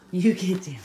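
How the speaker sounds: firm command. Real, not acted. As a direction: say it again, sterner. Repeated louder command to dog.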